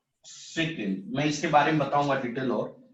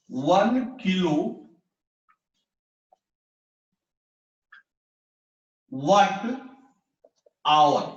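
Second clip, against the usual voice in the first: slow reading demo; much slower than usual. Shown as a demonstration. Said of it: "इसको पढ़ते हैं".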